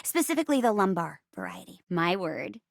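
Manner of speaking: normal talking voice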